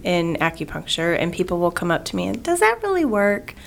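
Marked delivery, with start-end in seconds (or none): 2.4-3.5 questioning patient. Retold or imitated. Patients asking skeptically.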